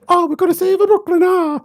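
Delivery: put-on high, over-the-top Italian accent. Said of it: "they do the stereotypical Italian accent of".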